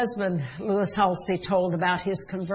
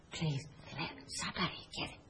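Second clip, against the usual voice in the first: barely voiced whispered plea; timid whisper; 4 semitones lower, 16 dB quieter, faster than usual. Shown as a demonstration. Preacher shows blind Bartimaeus asking the wrong way, whispered. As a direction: whisper it meekly, as if afraid to ask. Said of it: "No, it says he began to cry out".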